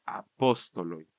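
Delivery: slow "apóstoloi", stress on PÓS; antepenultimate stress. Shown as a demonstration. Teacher showing plural vocative, slow stress on PÓS in "apóstoloi". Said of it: "Regresa a la posición en el vocativo".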